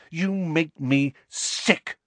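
high, slow, angry disgust. A narrator's reading. Lily finishes with James, disgusted. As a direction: spell out the disgust word by word. Lily's tirade ends in disgust.

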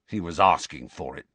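ordinary delivery, nothing marked

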